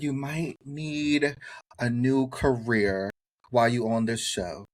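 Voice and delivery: drawn out slowly, every word landing; slow cutting comeback. Retold or imitated. Retelling Tiffany's jab at him on the show, slow and pointed. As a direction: lay each word down slowly, like a put-down.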